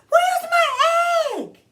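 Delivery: put-on a little girl shouting for her lost egg; panicked yell. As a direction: yell it in alarm at the missing egg.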